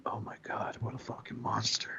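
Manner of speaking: hushed, voiceless "oh my God"; whispered horror. Retold or imitated. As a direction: whisper it like you're appalled.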